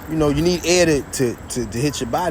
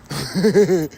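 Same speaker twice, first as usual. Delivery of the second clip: gloating laugh; 2 semitones higher than usual. Real, not acted. Gloating over the enemy's punishment.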